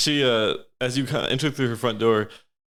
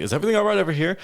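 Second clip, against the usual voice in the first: little halfling man's higher voice, questioning; lightly voiced halfling; 6 semitones higher, much faster than usual. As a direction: play a small halfling guard lightly. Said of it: "just, like, a, a little halfling man".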